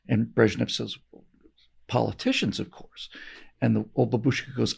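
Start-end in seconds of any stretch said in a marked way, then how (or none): 1.9-3.1 hushed, confidential whisper of a punchline; whispered aside. Retold or imitated. Brezhnev whispering the answer to the babushka. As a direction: lean in and whisper it like a secret.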